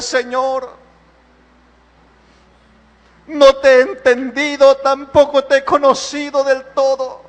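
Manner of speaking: earnest pleading prayer voice; fervent prayer. Acted. Pastor praying aloud before kneeling congregation, fervent and pleading. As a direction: pray aloud, pleading and humble.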